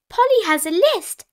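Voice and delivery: put-on high, loud child announcing proudly. A narrator's reading. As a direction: show off as a proud little piglet. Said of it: "Peppa said proudly".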